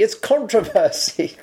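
"controversy" with CON pushed high and slow; stress on con. Shown as a demonstration. Arguing over a word's stress, slow and emphatic. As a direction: lean hard on the first syllable of "controversy". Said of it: "So it's not versy".

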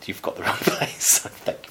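whispering the shopkeeper's brush-off; breathy whisper, half laughing; whispered quote. Retold or imitated. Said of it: "and he said".

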